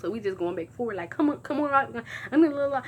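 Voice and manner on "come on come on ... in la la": excited. Retold or imitated. Excited friend on the phone.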